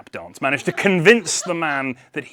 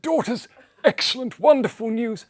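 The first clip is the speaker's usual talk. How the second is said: put-on high old man's voice bubbling with laughter; giddy old man; 12 semitones higher, 5 dB louder than usual. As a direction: offer your daughters with giddy glee. Father announces news.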